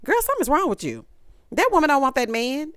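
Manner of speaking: ordinary talk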